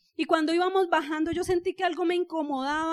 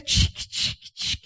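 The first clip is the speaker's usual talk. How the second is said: clicking noise; much slower than usual. Retold or imitated. Mimicking negative thoughts ticking.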